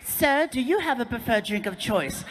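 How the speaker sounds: polite mock German stewardess; flight attendant's German accent. German attendant turns to the friend, polite German accent. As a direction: ask courteously in a German accent. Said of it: "she looks at Martin".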